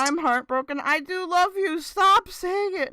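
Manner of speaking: playful fake lovesick plea to Earthy; mock heartbroken. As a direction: beg a chatter not to leave. Mock breakup with chatter.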